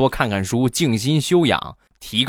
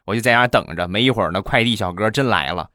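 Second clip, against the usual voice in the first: usual speaking voice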